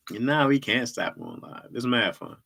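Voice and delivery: ordinary talk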